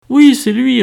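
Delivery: high, loud, slightly flustered reply; a little shaken. A narrator's reading. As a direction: answer as if rattled by the scene. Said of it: "un peu perturbée par la scène".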